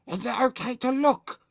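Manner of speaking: very high, frightened squeak; Anxious Andrew gasping. A narrator's reading. Nervous boy hiding eyes, frightened gasp. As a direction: gasp it out timidly, loud. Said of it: "gasped anxious Andrew".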